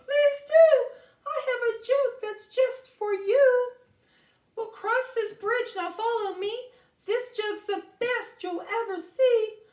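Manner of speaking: very high, loud cawing crow voice; Tony Crow. Acted. Crow lures Joey over the bridge, very high loud cawing. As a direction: squawk like a tricky crow.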